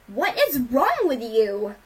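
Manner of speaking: loud, slow, drawn-out outraged yell. A narrator's reading. Rainbow confronting the father over the beating, yelling. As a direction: yell it in disbelief, stretching the words. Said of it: "Rainbow yelled at Cloud Dancer".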